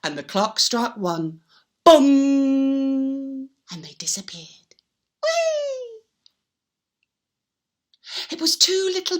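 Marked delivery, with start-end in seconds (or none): 1.8-3.5 booming bong like a clock. Acted. Storyteller sounding the clock's loud bong. 3.7-4.7 hushed whisper. A narrator's reading. Storyteller whispering to children as elves vanish. 5.2-6.0 high squealing whee; gleeful whee. Acted. Little elves whooping up the chimney.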